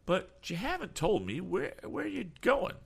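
a touch of a high character voice, questioning; lightly voiced eccentric man. A narrator's reading. Eccentric man asking the lad where he's headed, lightly voiced and curious.

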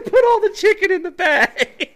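crying with laughter through the robber's line; wheezing laugh while quoting; barely gets the words out laughing. Retold or imitated. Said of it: "he said".

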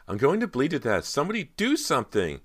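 high, loud panicked wail; screaming in agony. A narrator's reading. Persia bleeding, begging for help, high panicked cry. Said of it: "Persia screamed and cried".

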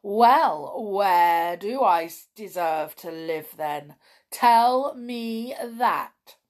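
defiant challenge, slowing at the end; slightly menacing storybook villain; wicked gnome. A narrator's reading. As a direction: dare him as a nasty little gnome. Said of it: "his little eyes glittering wickedly".

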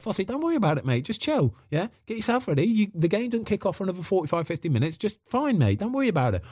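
calm the panicking player down, pitched high and gentle; reassuring manager. Retold or imitated. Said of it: "He was like".

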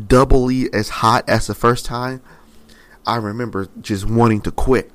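impassioned pulpit storytelling; preaching at full force. Acted. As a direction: build the story like a fiery preacher.

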